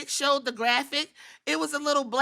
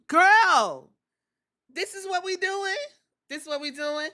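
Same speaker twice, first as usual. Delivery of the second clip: mock incredulous; 8 semitones higher than usual. Ignored friend confronting Bayley, high mock incredulity. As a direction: imitate a friend's disbelief, pitched up.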